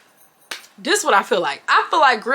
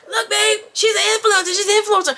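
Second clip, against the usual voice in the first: put-on eager girlfriend; 8 semitones higher, faster than usual. Tattoo voiced showing Instagram.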